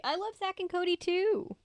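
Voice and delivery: squeaky high-pitched cutesy voice; high silly voice. Shown as a demonstration. Repeats in silly voice.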